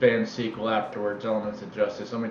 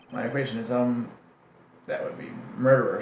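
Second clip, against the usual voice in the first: ordinary talk, nothing marked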